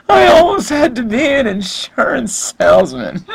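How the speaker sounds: high, wailing fake cry; hammed-up teary Tom Brady imitation; mock sobbing. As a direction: blubber it like a crying quarterback. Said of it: "he just starts sobbing".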